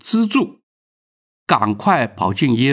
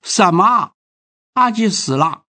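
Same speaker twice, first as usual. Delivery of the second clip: higher-pitched, mildly shouted disbelief; shocked cry; 7 semitones higher than usual. A narrator's reading. Grandmother learns her grandson Aji is dead, shocked cry. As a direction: narrate her startled outcry, pitched up. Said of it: "阿婆大叫说".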